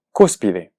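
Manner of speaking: cúspide as CÚS-pi-de, a standalone model word; cúspide stressed on the first syllable, falling away. Shown as a demonstration. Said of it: "es una palabra esdrújula".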